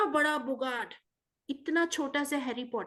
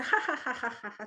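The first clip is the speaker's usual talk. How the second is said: spoken ha ha ha, mock laughing; acted laughter. Harry laughs at the shrinking Bogart, mock laughing. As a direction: laugh out the ha's in a playful mock laugh. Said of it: "फिर वो और जोर से हंसा".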